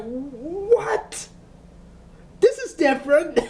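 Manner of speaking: high, amazed disbelief; thrilled surprise at a twist; delighted shock. Retold or imitated. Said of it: "I was like".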